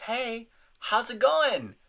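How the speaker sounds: high, slow sing-song hello; mock chirpy front desk welcome; cheerful receptionist greeting. Showing how a receptionist should greet, high and sing-song. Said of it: "your job as the receptionist".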